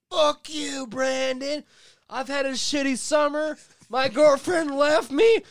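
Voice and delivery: high-pitched, strained angry yell; Hulk-like rant. Retold or imitated. Retelling his blow-up at Brandon, hammed-up Hulk rage. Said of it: "all Hulk-like and saying".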